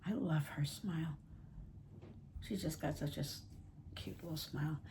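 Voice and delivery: soft whispery fondness; hushed, doting on the doll; tender. Real, not acted. Fond talk about doll.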